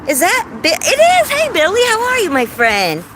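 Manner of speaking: very high, loud delighted squeal; excited recognition. Real, not acted. Streamer spotting friend Billy in chat, excited recognition. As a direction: shriek a happy hello as you spot them. Spots a friend joining the live.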